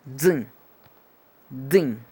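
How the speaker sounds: "dun" as an isolated model sound; phonetic TH demo. Shown as a demonstration. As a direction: demonstrate the opening sound of "dun". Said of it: "Es más bien de dun".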